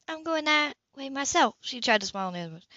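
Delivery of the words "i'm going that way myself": light Sybil voice. Acted.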